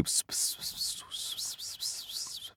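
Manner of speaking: breathy whispered ps ps; whispered signal. Acted. Brer Rabbit giving the birds the secret whispered signal. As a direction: hiss the secret signal softly.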